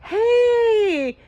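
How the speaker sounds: high, loud squeal of a hello; excited greeting. Retold or imitated. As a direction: shout a delighted hey back. Greeting Tank back excitedly.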